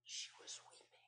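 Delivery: a bare breathy whisper. Acted. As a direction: breathe the words out without voice. Mary weeping at the grave.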